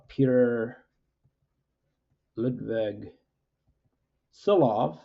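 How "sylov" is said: Sylow said as "SEE-lof"; Norwegian pronunciation. Shown as a demonstration. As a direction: end Sylow in an f sound, like "lof". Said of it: "it should be Sylow".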